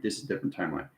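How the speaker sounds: ordinary talk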